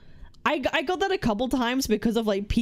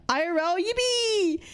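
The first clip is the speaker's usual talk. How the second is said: mock cheer; 9 semitones higher, slower than usual. Mock cheer about IRL streams.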